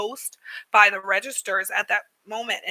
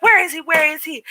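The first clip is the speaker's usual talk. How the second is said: imitated higher, louder urgent questions; urgent searching; 6 semitones higher, 4 dB louder, faster than usual. Shop lady hunts for the man, urgently. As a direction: play the woman ready to confront someone.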